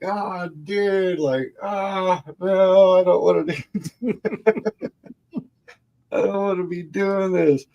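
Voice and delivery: high-pitched whiny protest; high, whiny "I don't wanna"; whiny cousin. Retold or imitated. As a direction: whine it like a grossed-out teenager. Cousin refusing to clean up.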